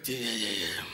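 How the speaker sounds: low weary groaning; old-age grumble noise. Retold or imitated. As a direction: grunt it out like an aching fifty-year-old. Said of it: "you start making noises".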